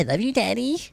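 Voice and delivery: put-on high childish call. Mock son calling dad.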